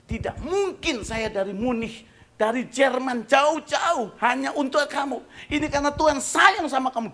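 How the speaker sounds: high, loud, slow preaching rebuke; impassioned shout. Retold or imitated. Pastor rebuking the host who hid a woman, loud shout. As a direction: thunder it with love.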